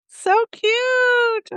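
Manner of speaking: put-on high baby-talk squeal; cooing at a kitty. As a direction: gush over something adorable, lightly.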